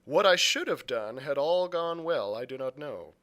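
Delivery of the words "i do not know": plain reading voice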